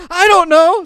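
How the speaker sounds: helpless blurt. Retold or imitated. Tackler shrugs it off.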